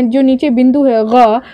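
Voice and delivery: normal talking voice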